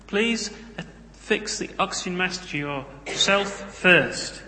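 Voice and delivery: put-on playful South African airline announcement accent. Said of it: "They say this in a South African accent".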